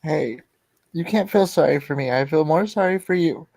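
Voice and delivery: usual speaking voice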